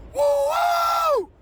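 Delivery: high, loud joyful whoop; ecstatic whoop. Real, not acted. Morning preacher whooping for his viewers, ecstatic whoop. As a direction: let out a wild happy whoop. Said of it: "Man, I get excited about this".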